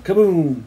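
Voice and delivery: booming falling kaboom; storyteller's sudden boom; bomb explosion. A narrator's reading.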